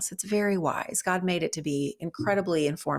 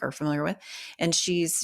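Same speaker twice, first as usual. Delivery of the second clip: normal talking voice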